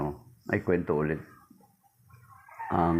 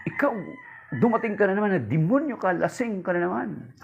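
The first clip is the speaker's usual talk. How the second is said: much higher, nagging scold; scolding wife; 10 semitones higher than usual. Acted. Wife scolds her drunk husband at the door, high and shrill-free nagging. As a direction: tell off the drunk husband coming home.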